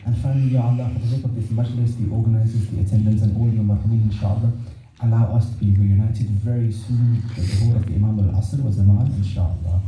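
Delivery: low-pitched, subdued prayer recitation; closing supplication prayer. Real, not acted. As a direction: lead the mourners in a low, solemn plea to God.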